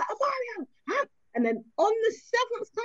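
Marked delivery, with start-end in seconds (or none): 0.0-1.0 name snapped high, questioning huh; sharp call-out. Retold or imitated. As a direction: call the son out, pitched way up. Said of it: "I'll be like".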